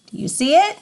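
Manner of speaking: ordinary talk, nothing marked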